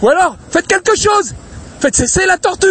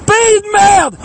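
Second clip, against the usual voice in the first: furious shout; 5 semitones higher, much slower than usual. Real, not acted. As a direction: hurl it out in rage. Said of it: "J'ai même pas la voix pour crier".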